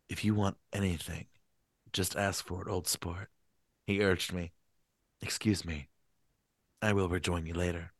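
soft, low, suave gentleman's voice; soft-spoken Gatsby urging his guest. Acted. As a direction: murmur it like a polished host. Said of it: "Gatsby voice".

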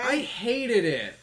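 high, slow drawn-out disgust. Real, not acted. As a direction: groan out the hate. Disgusted reaction.

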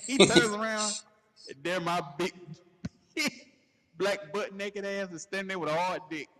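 usual speaking voice

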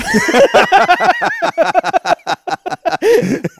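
high, loud burst of laughter. Real, not acted. Big laugh at story.

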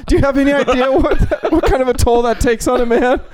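high, laughing Michael voice; Michael Scott impression. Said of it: "He's like".

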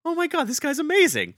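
high, loud fanboy squeal; starstruck gush. Retold or imitated. Starstruck fan gushing to himself.

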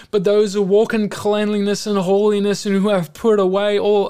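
loud, high, fervent preaching; preaching at full force. Acted. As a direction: preach it hard, rising with fire.

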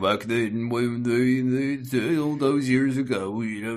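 slow, wavering reminiscence; rambling old storyteller. Retold or imitated. Keeper retelling the beast's old attack, slow rambling voice. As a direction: drift through a half-remembered tale.

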